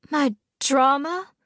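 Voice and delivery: disbelieving. A narrator's reading. Fiancée to Hunter, stunned disbelief. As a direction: repeat it as if you can't believe it.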